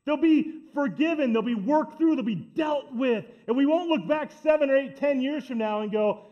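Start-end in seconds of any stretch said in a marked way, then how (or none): none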